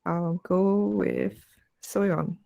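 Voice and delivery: usual speaking voice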